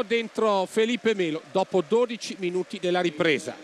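ordinary talk, nothing marked

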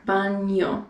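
"Baño" with an NY sound as in canyon; chewed Spanish Ñ. Shown as a demonstration. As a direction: chew on the N of "Baño": "BAHN-yo". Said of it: "chew on the N a little bit more".

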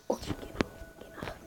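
hushed urgent whisper. Real, not acted. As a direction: whisper the order. Quiet instruction.

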